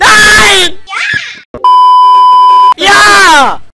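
panicking gamer. Real, not acted. Shouting during game.

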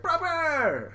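very high mock-cute exclamation; excited cheer. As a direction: cheer like a bubbly anime sidekick. Carrot cheers Chopper.

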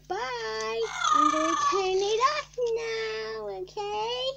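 high, loud cheery bye; excited goodbye. Real, not acted. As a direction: sing it out high. Loud sign-off to viewers.

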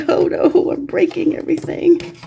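mock panic. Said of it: "I was like".

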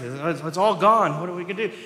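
father crying out over his smashed idols; ruined father's cry. Retold or imitated. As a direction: cry out that everything is lost. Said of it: "he screams because he's ruined".

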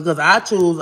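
normal talking voice

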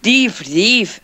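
playful mock yell. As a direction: call out a pretend thief alert. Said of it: "someone yells".

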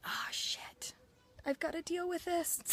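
sinking realization, first word barely voiced; mock dismay under the breath; hushed dismayed realization. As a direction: breathe out the curse, then voice it small and high. Reenacts waking up to her anger.

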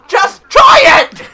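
high, drawn-out shriek; mock exasperated shout. Game character urging the player to try, hammed-up shout. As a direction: yell it loud and long, hammed-up.

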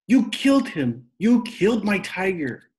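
high loud furious scream. A narrator's reading. Rob screaming at his father over the tiger. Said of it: "Rob screamed".

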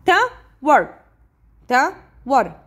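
tower in two beats, ta, wer; split into syllables. Shown as a demonstration. Teacher helping pupils count, tower split in two. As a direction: pause inside tower after the first syllable. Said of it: "إذا two syllables".

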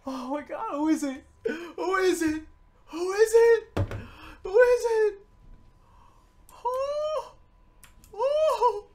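high, loud, frantic excitement; freaking out. Real, not acted. Player desperate to know who the card is, freaking out. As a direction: blurt it out high, overcome with excitement. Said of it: "I'm just freaking out".

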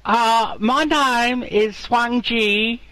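high, slow fake Chinese accent; a slow, high put-on Chinese accent. Pretend Chinese farmer gives his name, fake Chinese accent. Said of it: "I can't do the accent".

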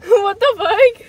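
shouting while laughing; loud laughing shout. Real, not acted. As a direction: yell it through a laugh. Headphones on, reacting loudly.

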